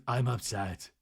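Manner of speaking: dropped pitch, fading on the repeat; retold upset. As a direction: imitate a deflated, quiet upset. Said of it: "And then Lisa goes".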